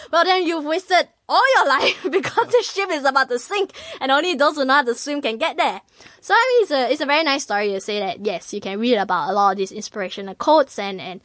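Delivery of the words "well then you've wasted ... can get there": laughing punchline. Acted. Old man's punchline in parable.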